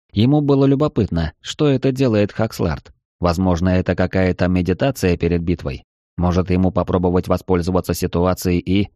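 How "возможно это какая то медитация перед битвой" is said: plain reading voice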